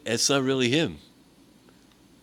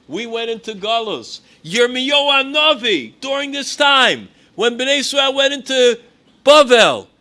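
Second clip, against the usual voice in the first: loud, fervent preaching, pitched higher; preaching at full force; 7 semitones higher, 8 dB louder than usual. Acted. Preacher telling of the prophet arguing with God, fervent and loud. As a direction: thunder it out to the crowd. Preacher argues Yirmiyahu's case.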